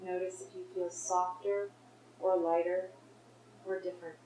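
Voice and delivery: guided meditation. Real, not acted. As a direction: gently guide them to notice, leaving space.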